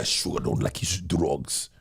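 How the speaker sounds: put-on low, slow Jamaican accent. Quoting a Jamaican character from Top Boy, low Jamaican accent. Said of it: "He was a Jamaican guy".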